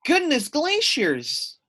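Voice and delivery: high, slow squawk. Acted. Dovekie Lovekey squawks at the melting news. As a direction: squawk it out in shock. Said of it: "squawked Dovekie Lovekey".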